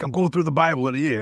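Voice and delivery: quick, high, raspy Italian granddad; Pepe's raspy Italian voice. Retold or imitated. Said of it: "He's got this Italian raspy voice".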